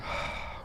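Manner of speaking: soft, unvoiced sigh out; quiet guiding out-breath; demonstrated exhalation. Shown as a demonstration. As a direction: breathe out audibly to lead the listener.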